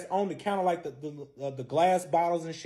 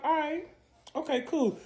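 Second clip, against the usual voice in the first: high, feigned acceptance; high-voiced act of being cool; retold mock acceptance; 10 semitones higher, 4 dB quieter than usual. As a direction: pretend it's fine, pitched way up. Acted acceptance on being left alone.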